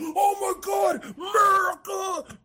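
drawn-out, high mocking yell of Oh my God, America; hammed-up outraged patriot shouting slowly; mock patriotic shout. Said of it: "People are like".

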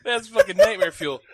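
high, loud, laughing through it; laughing disbelief. Real, not acted. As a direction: laugh it out, spooked. Laughing at creepy voice.

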